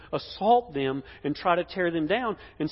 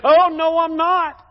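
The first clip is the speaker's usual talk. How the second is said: loud, high protest; emphatic denial; 11 semitones higher, 9 dB louder, slower than usual. Acted. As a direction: deny it loudly in a high voice. Acts Peter denying Jesus to accusers.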